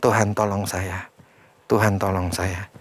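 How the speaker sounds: soft, humble plea to God; child's prayer. Retold or imitated. Child asks God for help after discipline, soft humble prayer. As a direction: pray quietly like a small child asking help. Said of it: "minta tolong sama Tuhan".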